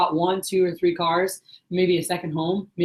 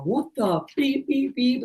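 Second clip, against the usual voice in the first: higher-pitched bleeped-out outburst; mock cussing; 6 semitones higher, 3 dB quieter, much slower than usual. Sales rep cussing at the manager on the phone, mock outburst. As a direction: imitate an upset caller, drawing out the beeps. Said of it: "Like, cussing on the phone".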